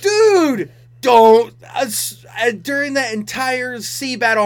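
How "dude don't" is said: exasperated plea. Retold or imitated. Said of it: "I'm like".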